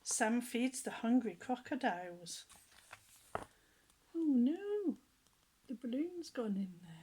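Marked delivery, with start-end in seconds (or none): 3.3-7.0 oh no surprise. Real, not acted. Reader alarmed as the balloon drifts to the crocodiles. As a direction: gasp out a worried oh no.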